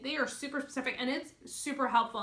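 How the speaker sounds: usual speaking voice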